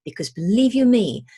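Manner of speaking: ordinary delivery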